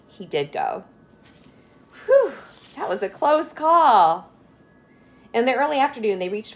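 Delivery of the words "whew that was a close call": higher, louder, slow relieved sigh; relieved whew. A narrator's reading. Narrator sighs relief after the policeman leaves. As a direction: let the relief out.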